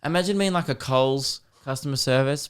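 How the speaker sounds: ordinary talk, nothing marked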